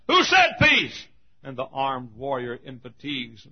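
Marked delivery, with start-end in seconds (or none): 0.1-1.1 high, loud shouted question; crying out. Acted. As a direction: cry it out like the wounded in a foxhole. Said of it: "lying in the foxhole cried".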